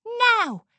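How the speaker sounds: loud high yell. A narrator's reading. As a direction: shout the signal.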